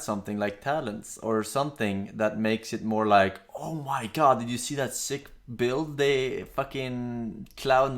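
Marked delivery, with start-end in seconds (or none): none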